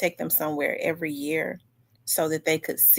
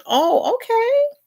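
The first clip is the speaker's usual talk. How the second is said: surprised okay; 4 dB louder than usual. Real, not acted. Reacts to viewer's answer.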